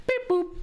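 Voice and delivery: high beep boop; chirpy robot noise. Pretend.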